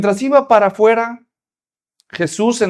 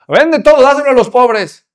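loud, higher accusing questions; stern pastor; 6 semitones higher, 6 dB louder than usual. Acted. Pastor interrogating the rich visitor, loud accusing. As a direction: grill him like an angry pastor.

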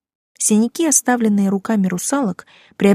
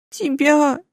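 sobbing; 7 semitones higher than usual. Acted. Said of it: "всхлипнула Ульяна".

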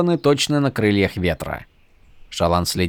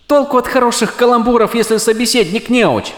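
high, louder grumble; exasperated; 11 semitones higher, 4 dB louder than usual. A narrator's reading.

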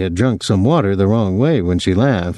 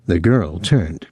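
usual speaking voice

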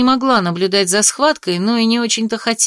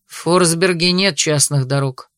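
dry and clipped; 5 semitones lower than usual. A narrator's reading. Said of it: "сухо отчеканил маршал".

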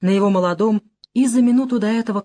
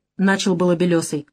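ordinary delivery

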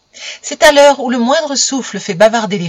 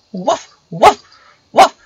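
angry imitated dog barks; furious barking; 5 semitones higher, 5 dB louder, slower than usual. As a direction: bark it out like a furious little dog. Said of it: "aboya-t-il tout à fait furieux".

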